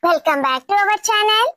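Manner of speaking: upbeat, sing-song presenter read; channel intro. Shown as a demonstration. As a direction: greet the viewers and plug the channel with lively swoops. (Channel పరిచయం చేసే హుషారైన anchor శైలి)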